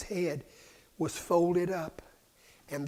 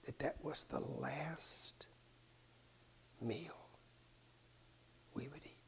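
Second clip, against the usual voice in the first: slow whispered words, much softer; solemn whisper; 17 dB quieter than usual. Acted. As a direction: let it fall to a hush. Solemn question of the last meal.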